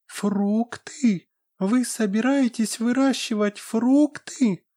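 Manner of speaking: high squeaky excited fairy; pixie. Acted. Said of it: "запищала фея".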